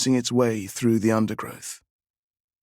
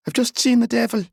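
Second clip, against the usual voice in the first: put-on high, fast and breathless child's cry; frightened boy; 13 semitones higher, faster than usual. A narrator's reading. Boy tells his aunt he saw the devil, high and breathless. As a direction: blurt it out panting and scared. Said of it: "trying to control his panting".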